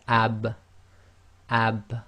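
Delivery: "ab" with a small echo as the lips part; Arabic ab with echo. Shown as a demonstration. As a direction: close on b then release with a bounce. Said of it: "feel the wet portion of your lips".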